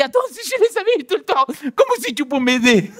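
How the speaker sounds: high, fast indignant outburst; exploding in outrage. Retold or imitated. Husband exploding at his wife over the kids, high and fast. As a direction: blow up at her, pitch shooting up.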